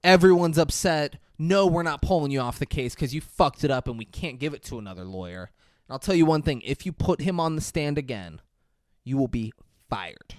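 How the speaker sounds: usual speaking voice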